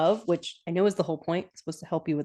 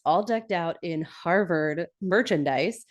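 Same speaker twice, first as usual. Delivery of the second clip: normal talking voice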